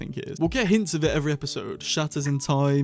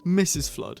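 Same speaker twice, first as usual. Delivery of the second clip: usual speaking voice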